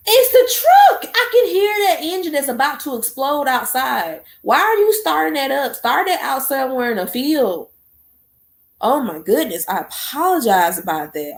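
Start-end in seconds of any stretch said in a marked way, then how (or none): 0.1-7.7 exasperated complaint. Real, not acted. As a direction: scold the truck driver in disbelief. Complaining about truck engine.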